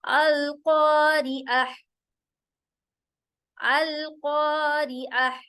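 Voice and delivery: slow, measured tajweed chant; Quran recitation. Acted. Teacher reciting the taawwuz to begin practice. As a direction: recite it slowly with long held vowels.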